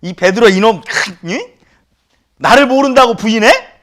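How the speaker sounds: high, loud scolding of Peter; hammed-up furious reproach; angry scolding. Preacher imagining scolding Peter, loud angry. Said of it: "저 같았으면".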